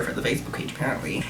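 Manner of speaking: a whispered catty aside; whispered sarcastic afterthought. Real, not acted. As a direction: drop to a breathless whisper for the dig.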